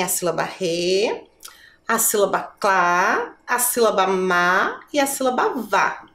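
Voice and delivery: RE, CLÁ, MÁ, VÁ each slowly held out; syllable by syllable. Shown as a demonstration. Teacher listing syllables for pupils, drawing out "re", "clá", "má", "vá". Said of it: "Vamos pensar nas sílabas dessa palavra".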